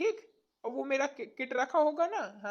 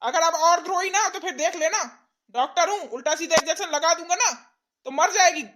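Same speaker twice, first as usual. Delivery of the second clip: angry shout; 3 dB louder than usual. Acted. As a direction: bark at her to shut up. Doctor shouting at patient.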